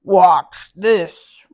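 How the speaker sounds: loud, slow booming giant voice; roaring giant. Acted. Giant boasting to Mickey, slow roar. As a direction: boast slowly and loudly like a giant. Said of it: "roared the giant".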